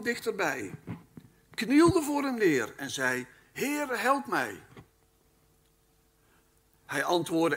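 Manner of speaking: loud, measured Bible reading from the pulpit; scripture reading. Acted. Preacher reading Matthew to the congregation, proclaiming.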